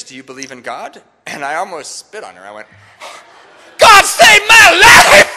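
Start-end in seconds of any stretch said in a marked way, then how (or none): 3.8-5.3 very high, slow, full-volume yell; explosive shout. Acted. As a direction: blurt it out at the top of your voice. Blurts answer, almost spitting.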